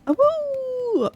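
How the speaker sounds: high wolf howl. Pretend. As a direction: throw your head back and howl. Howls in the game.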